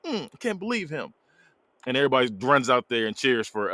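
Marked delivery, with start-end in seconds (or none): 0.1-1.1 high, soft huffing; lightly imitated pouty fit; mock tantrum. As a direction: act out a small tantrum under the breath. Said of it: "Candace throwing a tantrum".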